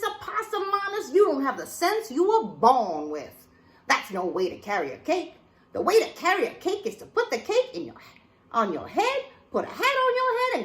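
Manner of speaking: high, loud exasperated mother; scolding mama. Acted. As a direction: lay into the child like a fed-up mama. Mama lectures child.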